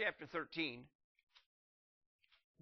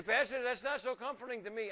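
higher and louder with a laugh; objecting congregant; 8 semitones higher, 5 dB louder than usual. Pretend. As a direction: play the doubter, laughing.

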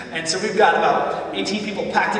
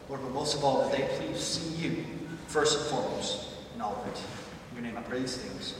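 soft, earnest prayer voice; closing prayer; 3 semitones lower, 4 dB quieter than usual. Acted. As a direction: pray it quietly and sincerely.

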